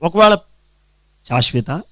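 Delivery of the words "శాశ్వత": low, soft name call with a questioning lift; soft first try at calling someone; ordinary call. Shown as a demonstration. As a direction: call the name quietly, ending on a rise. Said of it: "మొదట పిలుపు".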